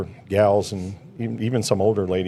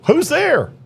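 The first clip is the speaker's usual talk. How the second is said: occupant asking. Retold or imitated. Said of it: "And they go".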